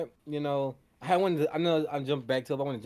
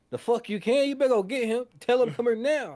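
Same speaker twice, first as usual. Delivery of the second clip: higher, louder imitation of an angry enforcer; mock angry demand; 8 semitones higher, 5 dB louder than usual. Fan imitating Smoke ordering Preacher Boy, mock angry. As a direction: bark the order like an impatient big brother.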